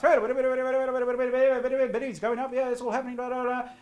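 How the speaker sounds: high, loud and drawn out, caught up in the bidding; auction excitement. Acted. Presenter acting out an auction heating up, excited and high. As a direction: stretch each word up high like the room is buzzing.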